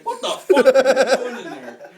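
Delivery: mock angry parent shout; parent yelling. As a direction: yell through the door like a parent.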